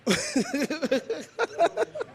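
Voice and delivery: a short rising embarrassed laugh; bashful laugh at himself. Real, not acted. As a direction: laugh off the slip. Laughs at his own stumble.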